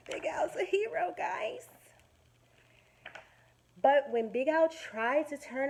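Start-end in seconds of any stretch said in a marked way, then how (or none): 0.1-1.7 excited praise. Acted. Praising Al to kids.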